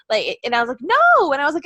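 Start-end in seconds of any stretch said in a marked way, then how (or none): 0.8-1.3 loud, high-pitched protest; retold indignant no. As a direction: shoot it down in loud outrage. Retold argument over hated wrestler.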